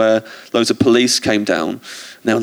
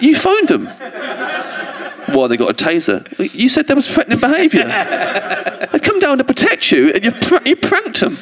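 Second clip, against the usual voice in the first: incredulous; 12 semitones higher than usual. Retold or imitated. Retelling argument with anti-frackers.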